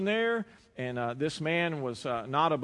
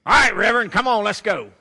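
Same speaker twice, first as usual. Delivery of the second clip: loud, higher call through the door; 7 semitones higher, 15 dB louder than usual. Retold or imitated. Funeral home man calls the reverend out, loud and higher. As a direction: hurry the preacher out, voice raised.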